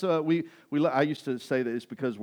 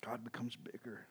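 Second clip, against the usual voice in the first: whisper; 4 semitones lower, 18 dB quieter than usual. Acted. Whispered echo of previous line.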